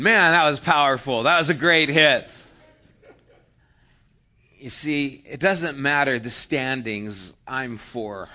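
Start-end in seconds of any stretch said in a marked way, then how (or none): 0.0-2.3 loud, high cheer for a big hit; excited cheering. Retold or imitated. Fans cheering a player's big hit, loud excited cheer. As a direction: shout it out like the crowd at the gym.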